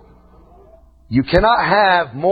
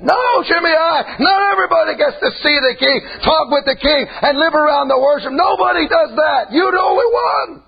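preaching at full force; 9 semitones higher than usual. Acted. Preacher shouting at Shimei that nobody sees the king. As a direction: build it up and shout the king's glory.

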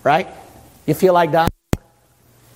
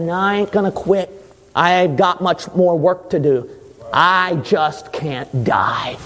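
resolute Jesus. Acted. Jesus refuses the devil.

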